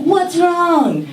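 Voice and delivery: lightly voiced kind West Coast stranger; concerned stranger. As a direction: rush to help a crying kid. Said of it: "they're like".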